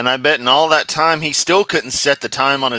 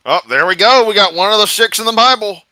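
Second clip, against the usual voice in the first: excited; 10 semitones higher, 10 dB louder than usual. Real, not acted. Excited over finding a ruby.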